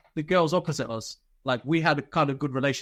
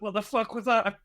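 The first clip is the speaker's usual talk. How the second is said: higher-pitched mock disbelief through amusement; imitated laughing instructor; 6 semitones higher than usual. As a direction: mimic a scolding that laughter keeps undermining. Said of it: "couldn't get his words out 'cause he was laughing".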